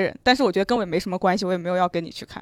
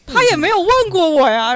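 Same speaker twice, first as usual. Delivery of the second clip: baffled; 7 semitones higher, 8 dB louder than usual. Retold or imitated. Her own baffled reply.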